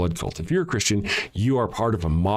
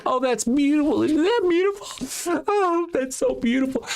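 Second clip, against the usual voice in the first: very high, cooing mock adoration; mock gushing believer. Mocking programmed Christians praising the sacrifice, high cooing voice. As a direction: gush like a starry-eyed churchgoer. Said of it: "you're so programmed to go".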